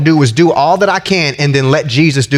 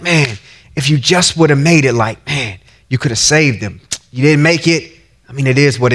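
just tell him how it hurts; sincere; 6 semitones lower than usual. Pretend. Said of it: "She was sincerely expressing".

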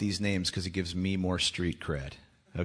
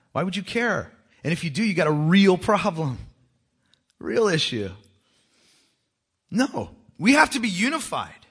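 raised, high-pitched preacher's challenge; impassioned preaching; 8 semitones higher, 6 dB louder than usual. Real, not acted. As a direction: challenge the congregation loudly and insistently.

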